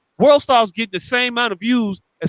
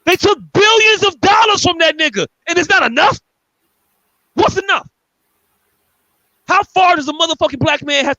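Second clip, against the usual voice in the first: outraged; 7 semitones higher, 3 dB louder than usual. Real, not acted. Outraged at apology.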